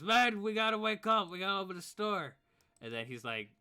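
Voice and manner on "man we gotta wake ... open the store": much higher and louder, rushing awake; yelling himself awake in alarm; panicked shout. Acted. Man realising he forgot to open the store, loud panicked shout. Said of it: "then he says".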